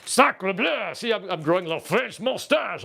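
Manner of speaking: hammed-up French voice while playing the viewer; mock French accent. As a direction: chuckle like a cartoon Frenchman. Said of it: "says Transformers Joe".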